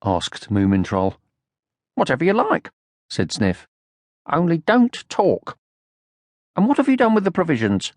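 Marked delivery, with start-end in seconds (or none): 1.9-7.9 bossy leader. A narrator's reading. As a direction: order him about importantly. Sniff bossy.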